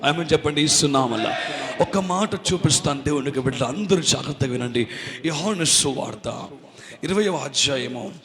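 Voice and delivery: normal talking voice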